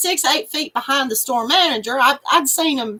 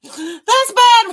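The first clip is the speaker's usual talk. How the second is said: laughing; 6 semitones higher, 5 dB louder than usual. Real, not acted. Laughing hard at story.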